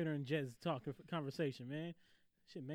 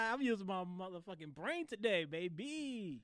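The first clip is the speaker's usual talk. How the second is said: high, loud, drawn-out whoop of self-congratulation; crowing; 8 semitones higher, 7 dB louder, slower than usual. Real, not acted. Host boasting about using his brain, crowing. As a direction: crow proudly, stretching the last word.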